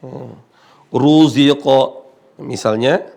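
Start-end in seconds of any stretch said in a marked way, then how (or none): 0.9-2.1 Ruziqa slowly, ru heavy with the tongue back raised; heavy Arabic ru; thick ru. Shown as a demonstration. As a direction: sound Ruziqa with a full, heavy ru. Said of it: "dibaca tebal".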